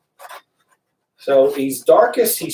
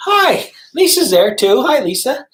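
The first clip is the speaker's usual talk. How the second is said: bright, high, loud hello to a viewer; light up as you spot a friend in the chat; excited greeting; 9 semitones higher, 5 dB louder than usual. Real, not acted.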